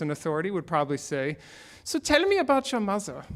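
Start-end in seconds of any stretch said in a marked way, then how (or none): 1.8-3.2 put-on higher, playful Austrian accent; mock Viennese psychoanalyst voice; Freud accent. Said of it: "That's my Freud accent".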